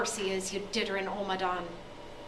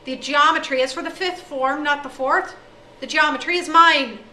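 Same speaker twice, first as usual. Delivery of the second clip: loud angry rant; 3 semitones higher, 9 dB louder than usual. Acted. O'Day rants at headmaster.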